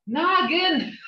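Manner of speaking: a touch of frustrated grandmother asking; mildly frustrated grandma. Retold or imitated. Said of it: "I was like, kind of a little bit frustrated".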